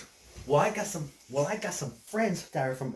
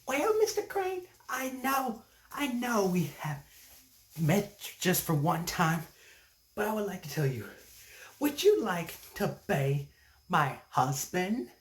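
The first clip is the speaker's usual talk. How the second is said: female kangaroo suitor; 5 semitones higher than usual. Acted. Kangaroo asking him to be her husband, high voice. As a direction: propose in a high, measured lady kangaroo voice. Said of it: "she said".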